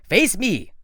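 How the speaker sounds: loud, high, cold command; menacing villain. Acted. Doctor Thorne ordering the kids to stop talking, loud and cold. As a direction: snap the order at the kids like a monster.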